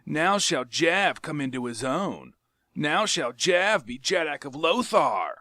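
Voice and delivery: lightly voiced sneer quoting him; mocking repeat. Tario mocking Jav by repeating his boast. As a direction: throw his own words back. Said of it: "I heard your words".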